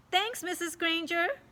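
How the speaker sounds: high, sweet innocent thanks; polite little angel; sweet boy. A narrator's reading.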